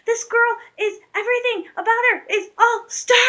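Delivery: very high, emphatic child impression; gushing young fan. Girls explaining her to their mom, high excited gushing. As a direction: mimic an excited girl explaining in amazement.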